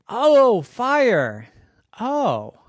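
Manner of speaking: high, loud awed oh; child discovering something wonderful; amazed child. Acted. Smelly marvels at fire.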